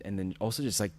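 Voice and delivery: ordinary talk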